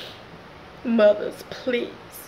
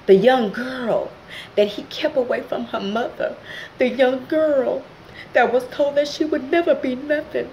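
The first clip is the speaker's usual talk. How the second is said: emotional testimony; 2 semitones higher than usual. Real, not acted. Speaker to viewers, telling her story with rising emotion. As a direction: build it like a testimony.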